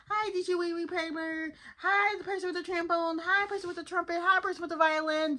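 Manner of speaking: high, eager hellos down the line; mock cheery greetings. SpongeBob greeting the band members, high eager hellos. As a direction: pitch it up and greet each player. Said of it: "He's like".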